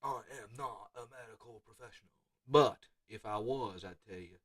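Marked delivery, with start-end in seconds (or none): 0.0-2.1 very soft, distant-sounding disclaimer; hushed mock disclaimer. Coach repeating his medical disclaimer, very hushed. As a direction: say it far under your usual level.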